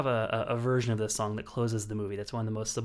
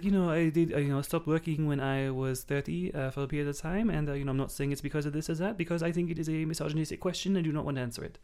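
slightly raised, put-on French accent; 4 semitones higher than usual. Host imitating a French actress rejecting an interview question, French accent. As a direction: lean into a mock French accent. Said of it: "go with that French accent every time".

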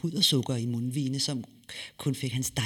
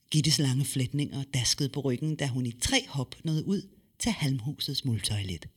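ordinary delivery

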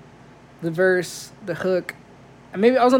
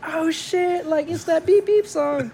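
much higher, hyped-up crowd voice, slowed; excited crowd; 9 semitones higher, slower than usual. Retold or imitated. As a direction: imitate a thrilled crowd hearing their song. Crowd recognizes the song excitedly.

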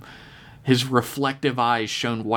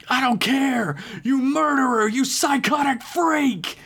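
high, furious yell; raging shout. Acted. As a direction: hurl it at the murderer. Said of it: "T K's voice rumbled the room around them".

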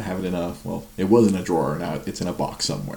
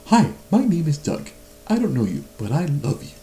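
high, eager dog voice; lightly voiced eager talking dog; Doug impression; 9 semitones higher, 5 dB louder than usual. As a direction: gush like the goofy talking dog. Quoting Doug from Up.

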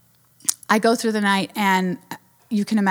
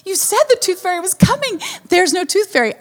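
put-on high, upset kid protest; hammed-up tearful child, pitched high; mock crying child; 9 semitones higher than usual. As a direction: imitate a let-down little boy complaining. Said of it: "He's crying. "I did all that work".

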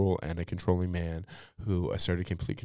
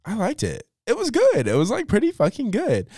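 much higher and louder, delighted; gushing praise; 6 dB louder than usual. Real, not acted. Telling listeners about Thanksgiving movie, excited gush. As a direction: rave about it.

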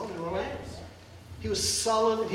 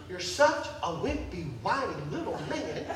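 scornful low; 6 semitones lower, 6 dB quieter than usual. Acted. Jezebel's scornful thought.

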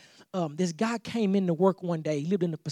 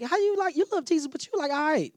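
pitched way up, incredulous, mock-baffled; amused disbelief; 8 semitones higher than usual. Friends baffled by a weird believer, high incredulous voice. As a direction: play the puzzled friend who can't square it. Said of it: "they're like".

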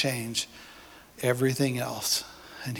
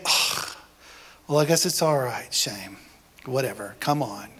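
mock reluctant shrug, lower and dropping; grudging, bored God; 4 semitones lower than usual. God grudgingly accepting the repentant Shane, lower bored sigh. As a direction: play a put-upon God barely bothering. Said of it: "God doesn't say".

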